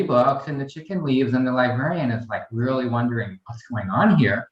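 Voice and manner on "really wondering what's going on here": normal talking voice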